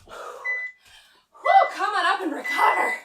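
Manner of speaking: laughing, winded, high whew; breathless laugh. Real, not acted. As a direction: laugh through the exhaustion. Laughing exhale after set.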